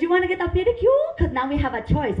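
imitated Vietnamese nail lady, starting high; Vietnamese accent. Nail lady offering a pedicure, Vietnamese accent. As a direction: sales pitch in a mimicked Vietnamese accent. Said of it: "I love their accent".

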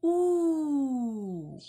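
U said long, high and loud; loud drawn-out U. Shown as a demonstration. Teacher showing the sound U to kids. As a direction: model the vowel U for the children. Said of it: "vamos colocar essa outra vogal aqui".

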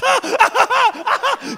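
mock agonised yelps; hammed-up pained cries. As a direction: wail high and slow as if pounded.

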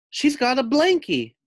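high, loud jeering howl; mocking howl. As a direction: crow it out high and loud to tease. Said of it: "I howl".

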